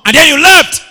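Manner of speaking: high, loud cry; preaching shout. Real, not acted. Preacher describing reconciliation to the church, shouting. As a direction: fling it out.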